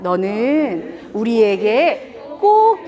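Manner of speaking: drawn out very slowly, word by word; slow and tender. Shown as a demonstration. Teacher shows parents how to praise slowly and tenderly. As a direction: say it slowly while holding their gaze. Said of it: "천천히".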